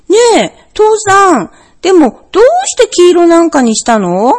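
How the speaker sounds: put-on a small child tugging at dad's sleeve; curious bear cub. A narrator's reading. Bear cub asks father why, curious and eager.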